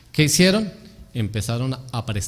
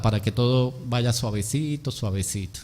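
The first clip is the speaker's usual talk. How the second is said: dropping away softer and lower; soft, gentle creeping; 3 semitones lower, 6 dB quieter than usual. Shown as a demonstration. Preacher illustrates the subtle strategy of infiltration, softly. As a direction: let "suavecito" sink almost to a murmur.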